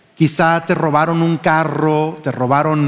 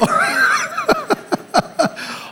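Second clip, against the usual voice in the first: real laugh; 4 dB quieter than usual. Real, not acted. Pastor laughing at his own joke to the congregation, burst of laughter.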